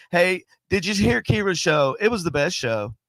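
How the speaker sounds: ordinary talk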